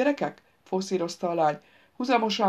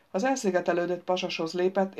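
ordinary delivery, nothing marked

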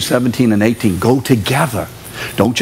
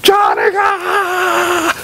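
frantic yell; much slower than usual. Retold or imitated. Parent screams a warning at a child near traffic, frantic shout. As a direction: shout the child's name as he nears the curb. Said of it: "Why are you gonna yell".

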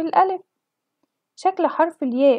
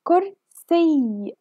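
very slow, drawn-out word; teacher sounding out the final sound; 3 semitones higher, much slower than usual. Shown as a demonstration. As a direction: stretch كرسي for the class to hear the ending. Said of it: "سمعنا صوت حرف الياء في آخر الكلمة".

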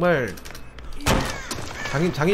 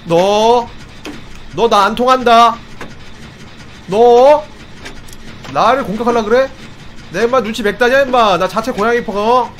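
loud taunting; 5 semitones higher, 3 dB louder than usual. Real, not acted. Taunting the killer in game.

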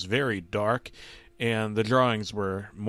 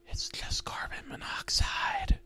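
soft, eerie whisper; 5 dB quieter than usual. Acted. Mystery voice names the toxic gas, whispered. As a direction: whisper it as a disembodied voice. Unknown cartoon voice speaks in story.

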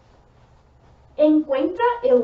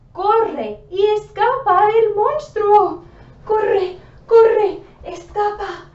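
frantic fleeing; 8 semitones higher, 8 dB louder than usual. Acted. Fleeing the monster.

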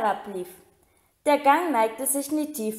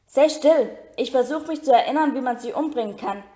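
urgent hushing. Acted. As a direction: rush the words while thinking hard. Hermine hushing Ron, flustered.

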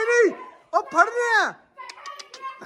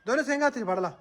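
soft coaxing; 8 dB quieter than usual. Real, not acted. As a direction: coax him quietly to hold on. Coaxing child to grip horns. (ਨਰਮੀ ਨਾਲ ਹੌਲੀ ਹੌਲੀ ਸਮਝਾਉਣਾ)

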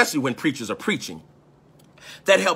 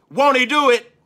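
church call-back; 8 semitones higher, 5 dB louder than usual. Retold or imitated. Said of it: "They might say".

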